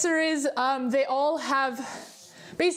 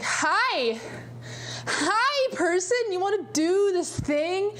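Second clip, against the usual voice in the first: high, slow, sugary hello; sweet high greeting voice; 6 semitones higher, much slower than usual. Retold or imitated. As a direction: coo it like a shy fan. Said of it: "and I'm just like".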